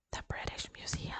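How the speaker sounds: breathy incredulous whisper; hushed, stunned whisper. Real, not acted. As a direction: whisper it in disbelief. Whispered repetition.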